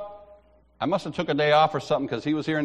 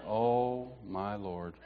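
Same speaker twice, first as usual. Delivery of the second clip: low, hushed dismay; soft, sinking voice; quiet dread; 7 semitones lower, 12 dB quieter, slower than usual. Retold or imitated. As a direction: mumble it to yourself in regret. Dismayed aside after the assignment.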